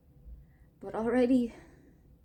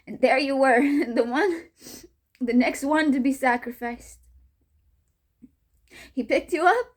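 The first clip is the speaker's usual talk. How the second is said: louder, harder and meaner; angrier; 2 semitones higher, 6 dB louder than usual. Acted. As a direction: spit it out with anger. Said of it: "meaner, angrier".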